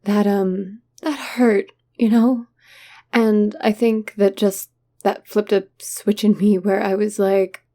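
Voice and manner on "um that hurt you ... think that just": ordinary talk, nothing marked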